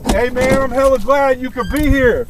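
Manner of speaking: loud, high call to a friend; yelling across the room. Real, not acted. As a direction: shout it over to your buddy. Calling out to friend George.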